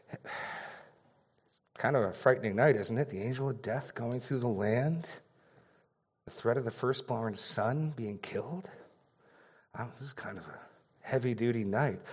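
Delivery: a frightened father confiding in his friend, hushed; worried father. Acted. One Israelite father telling another about the frightening night, quiet and worried. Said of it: "the first one says to the other".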